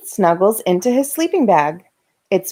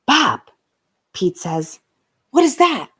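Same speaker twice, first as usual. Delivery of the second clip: scared whisper-call; 3 semitones higher than usual. Acted. Scared Pete wakes brother.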